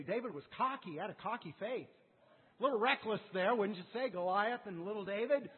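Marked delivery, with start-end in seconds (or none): none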